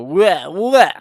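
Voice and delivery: high, loud mock baby wail; short wah that jumps up high; imitated baby cry. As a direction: do a baby's wail.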